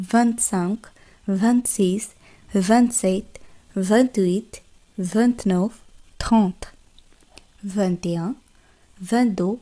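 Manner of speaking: soft, deliberate French twenties; model French pronunciation. Shown as a demonstration. Teacher counting twenty-one to thirty for students, soft and deliberate. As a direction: count gently with clear endings. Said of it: "teacher models French numbers".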